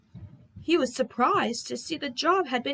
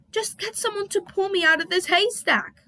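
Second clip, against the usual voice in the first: higher, flustered sputter; 9 semitones higher, 3 dB louder than usual. Acted. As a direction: splutter it in indignation. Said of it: "sputtered Harold".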